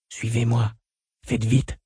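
hushed, urgent summons; soft call. A narrator's reading. Unseen soldier calling from the road above, soft call. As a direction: call out softly, keeping the volume well down. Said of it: "Une voix appela doucement".

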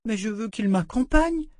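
put-on high child voice, pleading; a girl begging to keep her friend; pleading little girl. A narrator's reading. Rachel supplie la poupée. (voix de fillette suppliante)

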